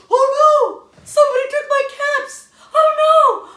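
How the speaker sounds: high, loud wailing cry of dismay; distressed cry. Acted. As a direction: wail over the stolen caps. Peddler finds his caps gone.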